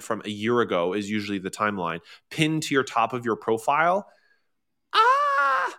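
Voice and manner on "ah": very high, held "ah"; sudden falsetto "ah"; mock cry. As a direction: squeal it out high in mock reaction. Mock reaction to own rhetorical question.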